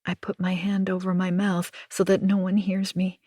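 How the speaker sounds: ordinary delivery, nothing marked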